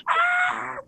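very high, thin falsetto shriek; mock girly scream, held back in volume; high-pitched scream. Shown as a demonstration. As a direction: squeal a high "ah". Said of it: "I could scream like a fucking bitch".

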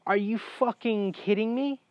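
high, rising disbelieving question; incredulous outrage. Retold or imitated. Patient learning his surgery was avoidable, incredulous.

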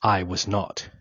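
plain reading voice

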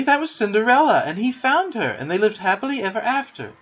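high, loud, excited telling; excited girl supplying the answer. A narrator's reading. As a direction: blurt it out with lifted pitch. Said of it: "said Wendy excitedly".

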